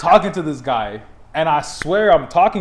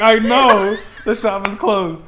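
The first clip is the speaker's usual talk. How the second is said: higher, slow, emphatic protest; pleading insistence; 6 semitones higher, 5 dB louder, slower than usual. Retold or imitated. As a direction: plead your case, dragging out the words. Pleading his story to cop.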